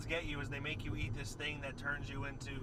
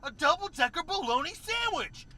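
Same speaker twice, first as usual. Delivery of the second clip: put-on excited kid voice; 12 semitones higher, 7 dB louder than usual. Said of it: "he goes".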